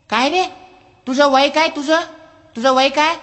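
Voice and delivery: high, squeaky mouse voice; cocky little mouse. Acted. Mouse asks the elephant its age, high and squeaky. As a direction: size the elephant up. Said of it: "हत्तीला म्हणाला".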